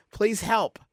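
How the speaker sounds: ordinary talk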